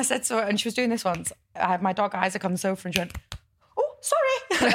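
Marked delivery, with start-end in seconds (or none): 3.8-4.7 high, squeaky little sorry; mock apology. Housemate apologising to the dog, high squeaky voice.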